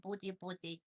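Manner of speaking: usual speaking voice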